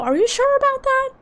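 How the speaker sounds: high, squeaky disbelief; can't believe the plot twist; incredulous. Real, not acted. Streamer doubting the game's twist, high incredulous squeak.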